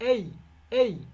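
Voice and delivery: এই, এই stretched slowly from এ into ই; slow repeated demo. Shown as a demonstration. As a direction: model এই, এই slowly for the class to repeat. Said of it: "please repeat". (ছাত্রদের জন্য ধীরে এই, এই)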